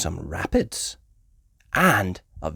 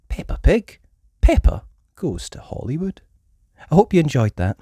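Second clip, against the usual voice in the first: plain reading voice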